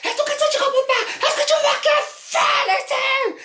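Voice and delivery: very high hysterical screaming; hysterical shouting. Retold or imitated. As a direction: play the raving madwoman, screaming. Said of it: "je commence vraiment à péter un plomb".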